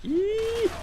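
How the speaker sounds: a sudden squeaky yelp of glee; high yelp. Acted. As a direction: squeal it out like a kid. Gleeful game yelp.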